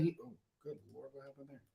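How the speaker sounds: usual speaking voice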